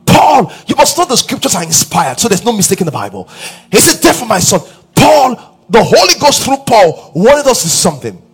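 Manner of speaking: loud, high, driving sermon delivery; fervent preaching. Acted. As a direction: preach it out to the whole hall, rising. Preacher building to full force.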